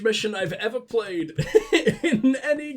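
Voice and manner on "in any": laughing, slow words. Real, not acted. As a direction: laugh your way through it. Laughing at the siege mission.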